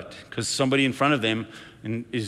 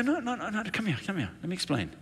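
eager correction; 7 semitones higher, 7 dB quieter than usual. Acted. Pastor acts out urgently correcting someone.

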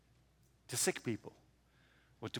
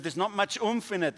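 normal talking voice

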